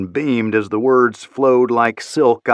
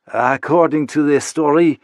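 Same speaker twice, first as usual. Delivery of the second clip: put-on slow French-accented read of news; 4 semitones higher than usual. Isabella reads the news to Chapman in French accent. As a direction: read the report in her French voice.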